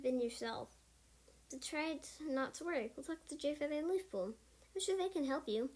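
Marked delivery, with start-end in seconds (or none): none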